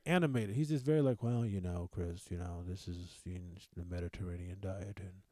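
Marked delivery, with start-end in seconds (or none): 1.2-5.2 low, soft, unmoved doctor drone; deadpan doctor. Retold or imitated. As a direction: talk like nothing could excite you. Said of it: "he's not very emotional".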